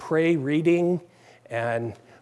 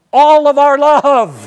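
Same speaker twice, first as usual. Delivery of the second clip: emphatic shout; 8 semitones higher, 11 dB louder than usual. Real, not acted. Preacher's climax.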